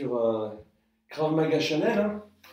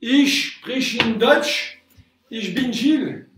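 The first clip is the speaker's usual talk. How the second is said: slow, careful schoolbook German; hamming up German for the students; speaking German on request; 6 semitones higher, 5 dB louder, slower than usual. Pretend. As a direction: spell out the German slowly and a bit louder. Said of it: "Tu pourrais parler allemand pour mes élèves".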